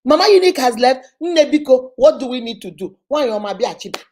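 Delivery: loud, eager pleading; excited, pleading voice; excited crowd quote. Retold or imitated. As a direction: call out like people missing her. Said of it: "Then they will say".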